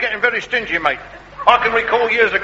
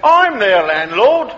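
slow, weighty stress on I'm; slow emphatic reveal; 3 dB louder, much slower than usual. Acted. Landlord revealing himself to the candidate, slow and emphatic. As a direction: land the twist slowly, leaning on I'm.

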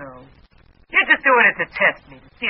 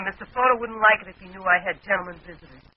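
normal talking voice

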